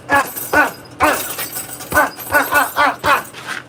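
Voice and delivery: sharp yell on each punch. Shown as a demonstration. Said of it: "why you yell when you punch".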